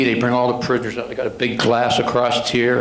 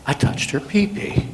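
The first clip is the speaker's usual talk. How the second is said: whispered, higher, ashamed repeat; 6 semitones higher than usual. Retold or imitated. Offender repeating his confession to the officer, whispered. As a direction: breathe it out so only he hears.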